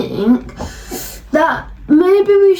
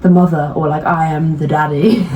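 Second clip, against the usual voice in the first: parent voice; 9 semitones lower than usual. Retold or imitated. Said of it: "It's like".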